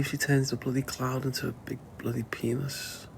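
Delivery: hushed, sly punchline under the breath; cheeky whisper. Real, not acted. Whispering the rude joke about Faith to the viewers. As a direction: whisper it like a naughty secret.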